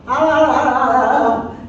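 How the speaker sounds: high-pitched mock chatter, imitating noisy colleagues; mock loud office talk. Lecturer mimics noisy colleagues in the office, mock loud chatter. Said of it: "They will talk very loud".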